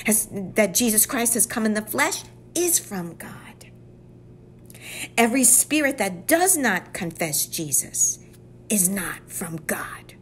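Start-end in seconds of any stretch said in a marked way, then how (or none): 2.5-3.7 unvoiced, slow whisper on "is from God"; whispered sermon emphasis. Real, not acted. 8.7-10.1 unvoiced, slow whisper on "is not from God"; whispered sermon emphasis. Real, not acted.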